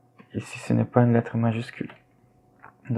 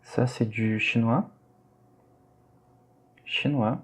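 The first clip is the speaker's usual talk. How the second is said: ordinary talk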